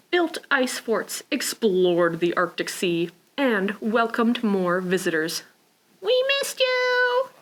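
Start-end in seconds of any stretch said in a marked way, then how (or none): 6.0-7.3 high slow happy cry; friends' greeting. Acted. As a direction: sing out warmly.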